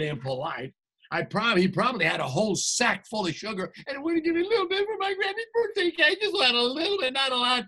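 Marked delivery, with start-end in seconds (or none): 3.9-7.6 high, fast whining; whiny complaint. Acted. Wolf complaining about the stingy pig, high fast whine. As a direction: whine like hard done by.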